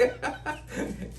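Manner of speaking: a short airy laugh up high; high breathy laugh. Real, not acted. Guru laughing at his own age joke, airy laugh.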